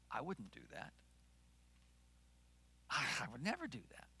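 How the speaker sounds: quiet, acted self-assurance; hushed denial. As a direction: drop suddenly to a near-murmur. Imagined smug denial after loud wondering.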